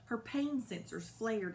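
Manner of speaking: ordinary delivery, nothing marked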